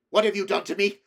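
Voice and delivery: a son confronting his mother; furious demand. A narrator's reading. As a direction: demand it in a rage. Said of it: "he demanded".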